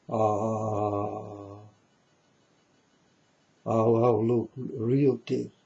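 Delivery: imitating a taken-in viewer, oohing at the tears; a touch of mock pity, lightly voiced; mock gullible viewer. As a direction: play the sucker who falls for fake crying.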